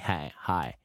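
low, soft American "hi"; faking an American accent, low and quiet; best American accent. Retold or imitated. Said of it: "je prends mon meilleur accent".

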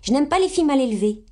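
put-on high, quick, haughty child; full of dignity. A narrator's reading. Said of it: "d'un ton plein de dignité". (d'un ton plein de dignité)

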